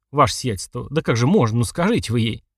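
ordinary delivery

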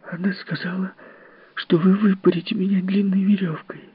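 put-on high, anxious little boy's voice; a frightened child pouring it out; agitated child. Timmy tells Miss Fellowes what the nurse said, high and anxious. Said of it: "взволнованно ответил Тимми".

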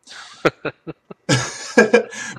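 short chuckle after a dry joke. Real, not acted. Host chuckling at own jab at EA, amused. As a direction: let out a brief amused laugh.

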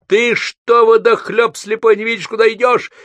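очень высокий громкий крик водителя; завопи на зазевавшегося пешехода; злой вопль. A narrator's reading. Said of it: "завопил". (возмущённый вопль)

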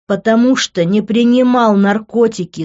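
heated denial; shouting. A narrator's reading. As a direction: shout it back defensively. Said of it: "закричал я".

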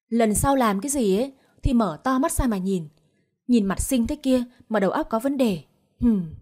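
usual speaking voice

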